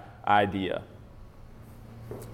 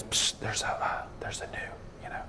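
soft conspiratorial whisper; playful stage whisper to the group; mock secret whisper; 14 dB quieter than usual. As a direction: lean in and hiss it like a secret. Pretend secret tip to the group.

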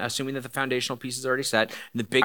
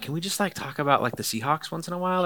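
higher-pitched, lightly exasperated request; acted plea; 6 semitones higher than usual. Worn-out date asking for lighter talk, mock pleading.

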